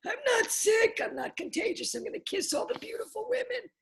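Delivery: high, far-off mock Trump voice; hammed-up Trump rally voice; Trump impression. Tipsy vlogger mocking Trump's rally voice. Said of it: "I sound like Trump at his latest rally".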